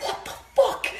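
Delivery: breathless high exclaim; mock exasperation. Puzzler to the missing piece, exasperated. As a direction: act out the frustration of a missing piece.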